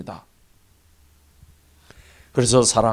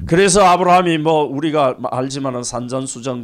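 normal talking voice

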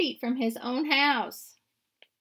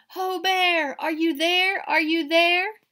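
higher, louder calls into the woods; calling out; 8 semitones higher, 7 dB louder than usual. A narrator's reading. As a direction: call across the forest. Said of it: "They call".

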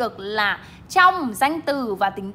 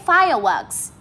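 fireworks with FIRE raised, works falling; stress on first syllable; 2 semitones higher, 5 dB louder, slower than usual. Shown as a demonstration. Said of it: "Fire thì các bạn lên giọng và works thì".

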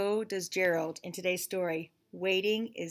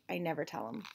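plain reading voice

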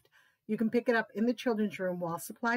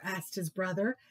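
plain reading voice

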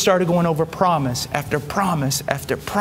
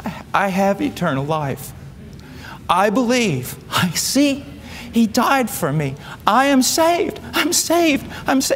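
rising higher and higher with joy; the dawning cry of a saved man, building up; overjoyed realization; 5 semitones higher than usual. Retold or imitated. Man suddenly grasps salvation, overjoyed.

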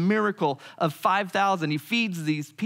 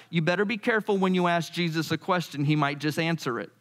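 usual speaking voice

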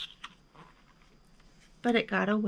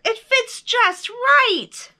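very high, loud, slow cheer; yelled; 13 semitones higher, 7 dB louder, much slower than usual. A narrator's reading. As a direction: shout it slowly in triumph. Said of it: "yelled Bear".

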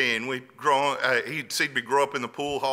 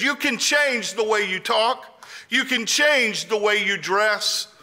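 higher, deliberate retold counsel; lightly acted older friend urging; urgent advice; 8 semitones higher, 4 dB louder than usual. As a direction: lay out each point insistently.

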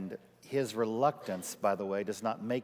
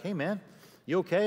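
friend checking in; 7 semitones higher, 3 dB louder than usual. Retold or imitated. Pastor friend checks on him.

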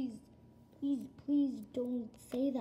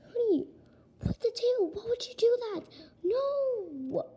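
shocked outcry; 9 semitones higher, 5 dB louder than usual. Acted. Mother reacts to the news.

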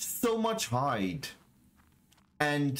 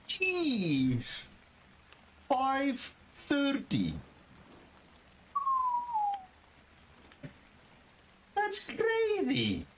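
awed disbelief; 14 semitones higher, much slower than usual. Real, not acted. Awed at item level.

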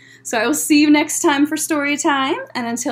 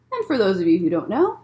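plain reading voice

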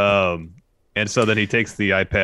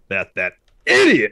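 idiot drawn out slow and louder; slow loud sneer landing on idiot; emphasis on idiot; 2 semitones higher, 7 dB louder than usual. Retold or imitated. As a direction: lean hard on idiot, slowly. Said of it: "I love his emphasis on idiot".

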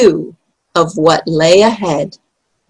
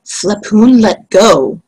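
plain reading voice

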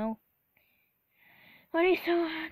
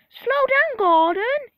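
high, very loud warning cry; warning shout; 11 semitones higher, 14 dB louder, slower than usual. A narrator's reading. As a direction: yell an urgent warning to the engine. Driver warns the engine.

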